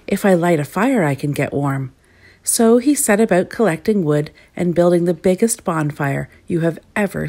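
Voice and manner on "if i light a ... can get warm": plain reading voice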